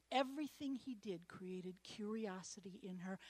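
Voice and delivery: soft unvoiced whisper. Real, not acted. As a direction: lean in and whisper it. Hushed emphasis.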